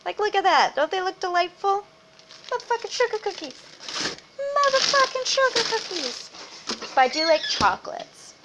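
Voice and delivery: high, silly show-off voice; playful put-on voice. Real, not acted. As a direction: present cookies with goofy pride. Showing off cookies, playful voice.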